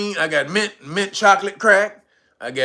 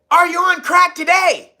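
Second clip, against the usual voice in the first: reporter heckle; 11 semitones higher, 4 dB louder than usual. Retold or imitated.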